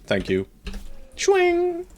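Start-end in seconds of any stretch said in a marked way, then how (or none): 1.2-1.9 squeaky voice. Acted. Voicing a unit's attack.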